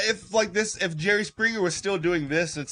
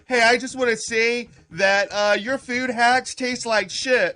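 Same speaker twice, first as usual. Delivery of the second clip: higher, louder mock audience member; slow down on the punchline of the complaint; audience heckler; 6 semitones higher, 4 dB louder than usual. Audience member confronting King Cobra, mock indignant heckle.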